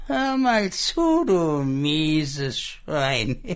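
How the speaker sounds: high taunting pig, slowing on the insult; threatening poor pig. Acted. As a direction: drag out the insult with menace.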